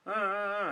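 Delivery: imitating a child's fake sobs; mock toddler sob sounds; mock child crying. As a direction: do a boy's whiny crying noises. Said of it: "and he's crying".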